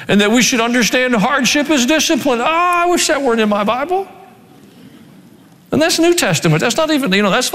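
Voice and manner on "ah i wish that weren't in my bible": pitched up, whining like a reluctant believer; mock whiny complaint. Preacher mocks a reluctant believer, whiny. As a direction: sulk about the verse.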